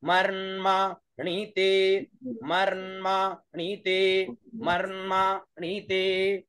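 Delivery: मर्माणीते rising on Marma, middle on मा, dropping on ते; Vedic pitch accent. Shown as a demonstration. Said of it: "मर्माणी और ते में नीचे".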